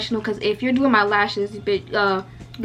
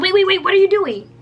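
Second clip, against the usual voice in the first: higher, louder, cutting someone off; alarmed objection, pitched up; sharp objection; 8 semitones higher, 5 dB louder than usual. Retold or imitated. Lash tech catches client switching camera on, sharp objection.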